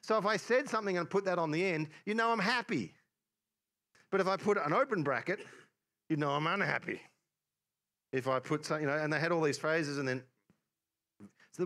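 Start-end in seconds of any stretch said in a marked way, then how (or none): 2.0-2.9 higher, upbeat lift on happy. Shown as a demonstration. Preacher explaining the smiley to the congregation, happy. Contrasting happy and unhappy emoticons. 6.1-7.0 understated unhappy tone, falling at the end; mildly unhappy. Shown as a demonstration. Preacher explaining the open bracket, mildly unhappy. Contrasting happy and unhappy emoticons.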